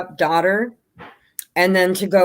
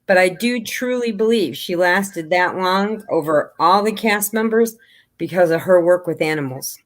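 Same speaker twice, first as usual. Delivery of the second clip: ordinary delivery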